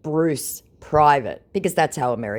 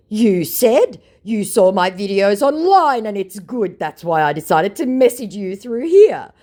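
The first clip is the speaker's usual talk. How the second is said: mock scammer voice; 8 semitones higher, 7 dB louder than usual. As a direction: drag it out like a clumsy liar. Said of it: "said my idiot scammer".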